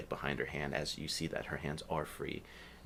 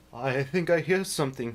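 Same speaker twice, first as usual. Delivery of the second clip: orc half-raised, meekly unsure; a big orc sounding timid and small; 9 semitones higher, 7 dB louder than usual. Acted. Orc tells the group he hears something, timidly. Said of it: "says in a very timid voice".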